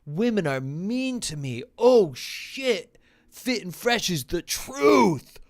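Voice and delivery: mock amazement, dripping sarcasm; sarcastic mind-blown voice; sarcastic awe. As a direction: fake being blown away. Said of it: "And it's like".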